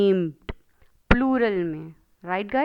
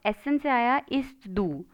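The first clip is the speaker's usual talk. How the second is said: usual speaking voice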